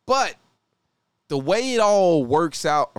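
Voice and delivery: put-on slow, drawled Southern country accent. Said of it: "you got me stuck in my country accent".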